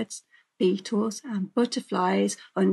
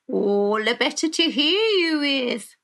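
loud, swooping sly wolf; hammed-up storybook villain; wolf as grandmother; 5 semitones higher, 5 dB louder than usual. A narrator's reading. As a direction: swoop up and relish the reply. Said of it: "he replied".

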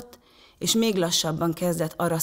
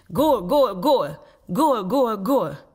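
loud, higher triumphant chant; repeated goal shouts; 4 semitones higher, 5 dB louder, much slower than usual. A narrator's reading. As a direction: celebrate with punchy, repeated cries. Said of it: "ismételte Anton".

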